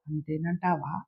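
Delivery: very soft, low, deflated question; dejected. A narrator's reading. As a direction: let all the hope drop out of it. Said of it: "నిరుత్సాహంగా అన్నాడు బుచ్చిబాబు". (నిరుత్సాహంగా, నీరసంగా)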